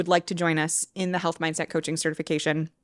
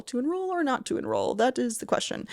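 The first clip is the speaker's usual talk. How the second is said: hammed-up Shakespeare line, higher and softer; mock Hamlet; 6 semitones higher, 6 dB quieter than usual. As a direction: play it as a theatrical soliloquy. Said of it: "Hamlet parody".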